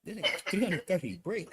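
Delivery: ordinary talk, nothing marked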